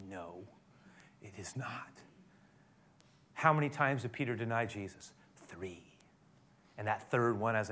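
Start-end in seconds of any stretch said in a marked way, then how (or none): 0.1-1.9 much softer, drawing them in; soft, hushed answer. Real, not acted. Answering own question softly. 5.5-5.8 a whispered "Three."; whispered answer. Real, not acted. Count of denials.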